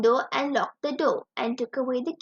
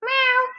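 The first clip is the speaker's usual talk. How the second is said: high, louder mew like a cat; cat meow; 9 semitones higher, 4 dB louder than usual. Acted. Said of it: "said the cat".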